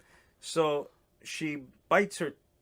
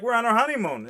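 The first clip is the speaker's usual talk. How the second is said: exasperated protest; 9 semitones higher, 7 dB louder, faster than usual. Retold or imitated. Defending himself to staring people.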